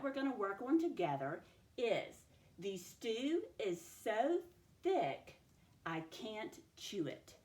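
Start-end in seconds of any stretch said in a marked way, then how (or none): 2.6-7.3 slow, word by word with pauses; sentence dictation. Shown as a demonstration. Teacher giving a sentence to write together, slow dictation. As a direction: dictate it deliberately, separating each word. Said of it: "move on to sentence dictation".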